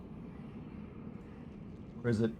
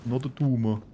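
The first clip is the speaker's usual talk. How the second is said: Arnold impression; 4 dB quieter than usual. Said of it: "Arnold Schwarzenegger".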